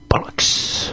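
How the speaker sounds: hushed, drawn-out mock British slang; British accent. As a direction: whisper it in a put-on British accent. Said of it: "I'm talking my British accent".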